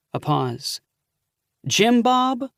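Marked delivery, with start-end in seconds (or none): 1.7-2.5 aunt's voice. Acted. Aunt guessing the caller's identity.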